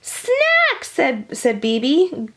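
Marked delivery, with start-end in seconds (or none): 0.0-0.9 whiny insisting. A narrator's reading. B B insists again.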